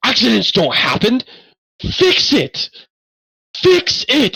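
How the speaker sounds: high-pitched furious outburst; angry. Acted. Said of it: "he angrily looks over at you and goes".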